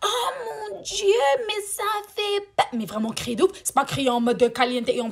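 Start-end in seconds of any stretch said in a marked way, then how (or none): 0.0-2.7 high, drawn-out mock shriek; exaggerated squeal, stretched out slowly; imitating their screaming. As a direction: wail it like a hammed-up horror fan. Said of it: "à crier comme elles ont crié".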